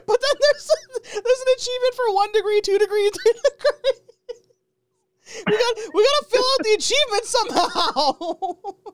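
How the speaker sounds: loud, very high squeaky laughing voice; near-crying with laughter; real helpless laughter. Real, not acted. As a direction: wheeze the words out while losing it. Helpless laughter while riffing.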